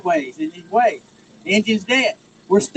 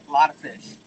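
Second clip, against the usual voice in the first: "a lot of fish" dropped lower and softer as an echo; 6 semitones lower, 6 dB quieter than usual. Real, not acted. Fisherman savours the big catch for the crowd, soft echo. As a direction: let "a lot of fish" fall away under your breath.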